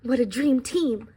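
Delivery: storybook read-aloud voice; reading to children. A narrator's reading. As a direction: tell the pie's story to a classroom. Reads the book aloud.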